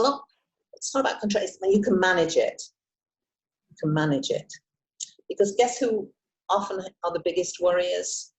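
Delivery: ordinary delivery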